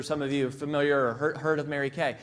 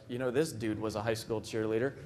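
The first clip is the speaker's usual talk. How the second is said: much lower, softer mock dude voice; skeptical guys; 7 semitones lower, 5 dB quieter than usual. Front-row men doubting the speaker, low mock grumble. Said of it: "looking at me like".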